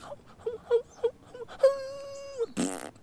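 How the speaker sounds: high, airy rhythmic noises, imitating her; mock her panting sounds; puffed-out squeaky moans; imitated moaning.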